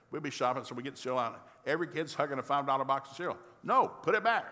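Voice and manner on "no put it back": put-on sharp, loud no to the kids; loud, high dad shout; scolding shout. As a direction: yell it like a fed-up dad.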